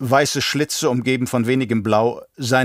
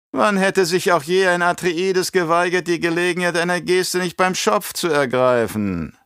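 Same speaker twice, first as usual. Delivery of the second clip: raised, theatrical voice of a scheming baron; menacing Baron; 9 semitones higher than usual. Acted. Baron scolding his nephew, theatrical and sly. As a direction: play the Baron, condescending and sly.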